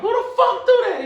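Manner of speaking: shrill-high, loud, laughing squeal; high pitched voice. Shown as a demonstration. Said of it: "My voice like".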